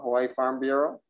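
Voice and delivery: ordinary talk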